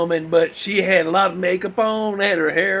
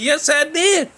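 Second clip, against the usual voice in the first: high-pitched insistent comeback; acted, rising-voice insistence; mock defensive retort; 9 semitones higher, 3 dB louder than usual. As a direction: retell it as a pitched-up protest. Retorting to buddy in retold banter.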